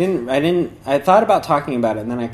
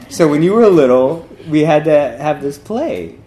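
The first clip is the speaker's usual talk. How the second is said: amused explaining; 4 semitones higher, 8 dB louder than usual. Retold or imitated. Laughing while voicing the awkward handler.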